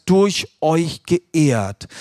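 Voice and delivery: "durch euch geehrt" drawn out very slowly, each word weighted; slow down and lean on "durch euch geehrt"; stress on durch euch geehrt. Shown as a demonstration. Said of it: "hier die Betonung legen auf".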